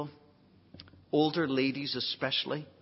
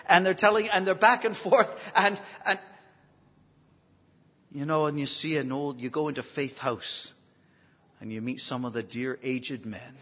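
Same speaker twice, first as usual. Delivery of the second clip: ordinary talk, nothing marked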